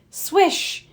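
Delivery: high, airy swish; sporty swoosh; swish sound effect. A narrator's reading. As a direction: make the swish of the net.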